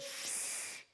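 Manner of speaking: long hushing "shh"; crowd's shush. Acted. Crowd silences blind Bartimaeus with a long shush. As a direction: shush someone making a scene. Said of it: "And everybody said".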